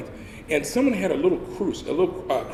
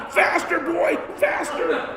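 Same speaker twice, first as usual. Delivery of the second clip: much higher, drawn-out shouts; yelling faster; 13 semitones higher, slower than usual. Retold or imitated. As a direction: drive him on from behind. Sergeant chasing recruit.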